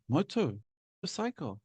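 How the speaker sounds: softly puzzling over moto-cycle, R dropped; thinking aloud. Acted. Teacher recalling confusion over Australian videos, thinking aloud softly. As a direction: murmur it to yourself in two pieces. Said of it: "I'm thinking".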